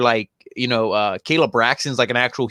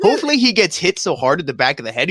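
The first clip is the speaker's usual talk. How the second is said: ordinary talk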